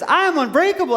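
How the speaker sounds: high, loud acted yell; yelled affirmation. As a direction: yell it with full conviction. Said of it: "yell it".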